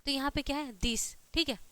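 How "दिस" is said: teacher's model "दिस", dental d at the start. Shown as a demonstration. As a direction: say "दिस" with Hindi द for TH. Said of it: "द होगा या फिर".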